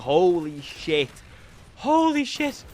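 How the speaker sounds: slow, drawn-out disbelief, rising high; amazed relief. Retold or imitated. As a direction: stretch it out in awe. Acted amazed relief at passing.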